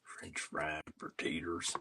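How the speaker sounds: lightly voiced Sling Blade, ending in a slow whisper; a hint of the Sling Blade character, drifting into a whisper; Billy Bob Thornton impression. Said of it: "That's my imitation".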